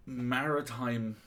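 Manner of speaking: ordinary delivery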